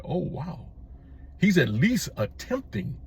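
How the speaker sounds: hushed, mild surprise; a soft 'well, what do you know' reaction; quietly impressed. Retold or imitated. Recalling his reaction to Trump in office, quietly impressed.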